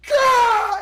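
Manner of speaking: sudden loud high yell of fright; startled war cry. Retold or imitated. Lying in the grass, attacked by a stranger: loud panicked yell. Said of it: "and I go".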